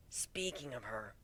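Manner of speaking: soft slow whisper; whispered aside. Acted. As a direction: hush it.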